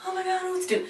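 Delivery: very high-pitched imitation of flustered panic; mock panic. Friends reacting to an intruding fan, high mock panic. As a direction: squeal it like a helpless onlooker. Everyone panics in retold scene.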